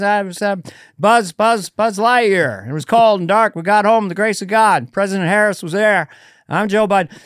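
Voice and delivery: high, loud halting Biden voice; Biden impression. Comic doing Biden's rambling story, high and loud. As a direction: stumble through it like Joe Biden. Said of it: "He says".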